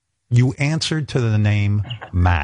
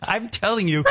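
talking through laughter; high, softened words breaking into laughter; laughing; 9 semitones higher than usual. Real, not acted. Interviewer cracking up at own speech.

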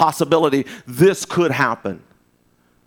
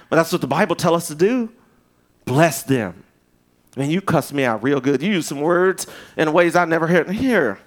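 normal talking voice

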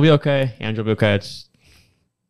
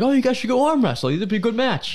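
egging them on; 9 semitones higher than usual. Retold or imitated. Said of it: "I'm the moron who was like".

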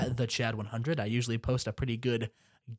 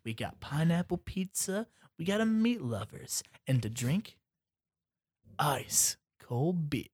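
weird host voice; 3 semitones higher, 5 dB quieter, slower than usual. Retold or imitated. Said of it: "why are you talking like this".

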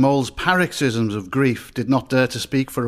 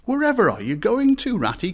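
a much higher, alarmed cry that drops on the name; tearful alarmed cry; 9 semitones higher than usual. A narrator's reading. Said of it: "cried the tearful Mole".

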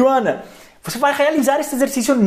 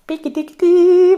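guincho agudo e brincalhão; piquitiquiti brincalhão; 9 semitones higher, 5 dB louder than usual. Pretend. Brincando com o espelho. (guincho de brincadeira)

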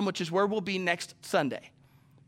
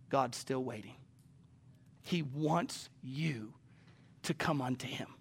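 whispered appeal; 6 semitones lower, 10 dB quieter than usual. Real, not acted. Preacher softening to a whisper.

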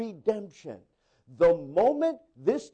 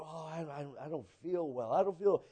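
faint, much softer and lower complaint; sick coworker; 8 semitones lower, 11 dB quieter than usual. Retold or imitated. Coworker telling the pastor, faint and sickly. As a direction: sound weak and unwell.

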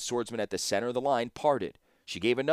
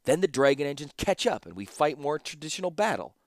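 snapping; 5 semitones higher, 4 dB louder than usual. Acted. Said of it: "snapped Ashnod".

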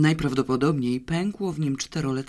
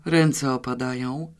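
usual speaking voice

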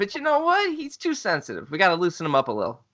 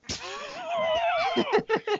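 high shrieking laugh; 5 dB quieter than usual. Real, not acted. Laughing at bartender joke.

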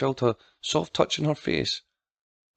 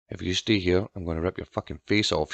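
lower, softer menacing repeat; low threat; 5 semitones lower, 5 dB quieter than usual. A narrator's reading. Girl threatens the new nanny, low threat. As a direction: threaten with the voice dropped. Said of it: "she repeated".

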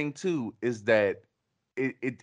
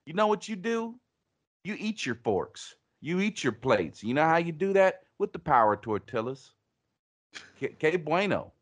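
hammed-up Southern pitchman; raised, mock-earnest Southern pitch; Tex Pablo; 5 semitones higher than usual. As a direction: preach it like a twangy salesman. Tex Pablo's mock sales rant.

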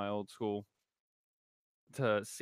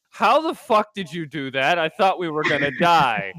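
retold friend's shocked outburst; shocked shout; 6 semitones higher, 13 dB louder than usual. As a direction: shout it like you just nearly died. Friend panicking after near crash.

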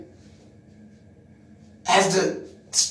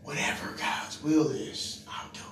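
whisper; 6 semitones lower, 10 dB quieter than usual. Retold or imitated. Preacher whispering his surrender to the meeting. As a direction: barely voice it, yielding. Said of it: "All I said is".

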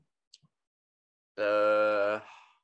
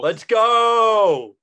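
excited cheer; 10 semitones higher, 10 dB louder than usual. Real, not acted. Cheering their election win.

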